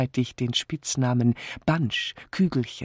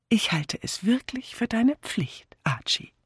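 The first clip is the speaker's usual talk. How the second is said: high-pitched, solemn wife; 11 semitones higher than usual. A narrator's reading. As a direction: declare it earnestly in a high voice. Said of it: "erklärte sie feierlich". (feierlich erklärend)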